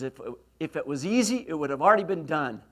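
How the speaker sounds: loud gruff coach imitation; forceful retold coach's lecture. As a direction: drive the point home like a gruff coach. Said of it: "with a gruff voice".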